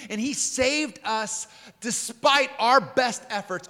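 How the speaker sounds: fervent sermon reading with weighted words; impassioned scripture preaching. Real, not acted. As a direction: proclaim the verses, pitch held high.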